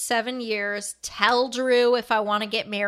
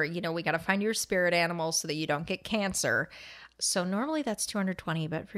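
whispered aside; 4 semitones lower, 6 dB quieter than usual. Retold or imitated. Psychic's advice retold to friend.

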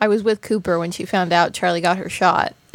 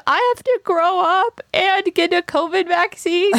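put-on much higher child voice; worried kid voice; 10 semitones higher than usual.

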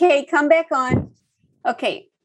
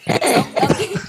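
put-on baby voice; 9 semitones higher than usual. Shown as a demonstration. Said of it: "Could you give us an example".

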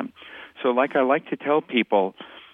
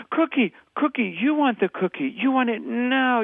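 put-on much higher, emphatic toddler-speak; baby talk; 11 semitones higher than usual. Shown as a demonstration. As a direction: mirror an upset toddler in short high bursts. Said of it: "does it feel like baby talk?".

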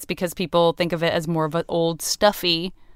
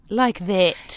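mock posh American. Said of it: "continental American accents where it's like".

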